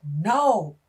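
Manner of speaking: a loud flat refusal; dad shouting. Retold or imitated. Angry father refuses to tell his daughter, loud. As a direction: bark the no at her. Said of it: "He's cussing, he's going off".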